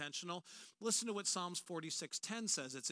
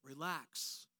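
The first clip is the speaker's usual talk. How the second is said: low, soft, soothing "Relax."; preacher reading God's command to the anxious, softly reassuring; gentle relax; 6 semitones lower, 4 dB quieter than usual. Acted. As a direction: breathe it out like a calm reassurance. God's word to the anxious, read gently.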